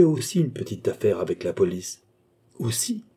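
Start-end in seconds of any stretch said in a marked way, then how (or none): 2.6-3.0 high, rising, softer startled question; small frightened query; alarmed exclamation. A narrator's reading. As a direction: echo it in sudden alarm, pitch shooting up. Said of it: "s'écria Oliva en pâlissant".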